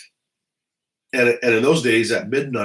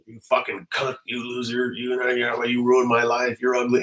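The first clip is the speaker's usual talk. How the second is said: mildly mimicked berating, a little higher; retold hateful mother; 4 semitones higher than usual. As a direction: imitate a spiteful mother running down her daughters. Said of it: "my mother was just hateful to these girls".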